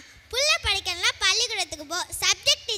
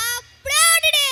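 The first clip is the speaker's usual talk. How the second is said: higher, drawn-out brush-off; brusque refusal; 5 semitones higher, 4 dB louder than usual. Acted. Local refuses the traveller begging for a phone, brusque refusal. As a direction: wave the beggar away with your voice.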